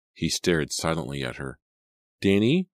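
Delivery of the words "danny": higher, louder questioning call; anxious cry. A narrator's reading. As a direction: cry out the name as a worried question. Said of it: "she cried".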